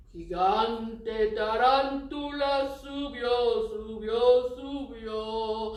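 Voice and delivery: slow, loud scary monster voice. Shown as a demonstration. Teacher doing the rhyme in a spooky voice, slow and loud. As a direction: drag it out like a spooky giant.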